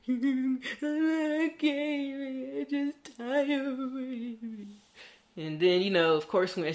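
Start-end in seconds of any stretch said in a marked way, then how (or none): 0.1-4.7 high, very slow, tearful and breaking; retold crying. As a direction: drag it out like sobbing. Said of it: "still crying".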